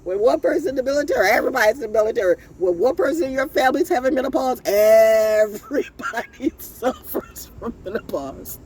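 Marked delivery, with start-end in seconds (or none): 4.6-8.6 drawn-out first word, then laughter; laughing punchline. Real, not acted. Joking that the whole family suffers, laughing. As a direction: stretch it out and break up laughing.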